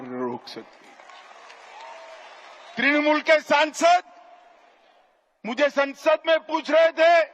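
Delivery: booming political rally speech. Real, not acted. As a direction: boom it out to a packed rally.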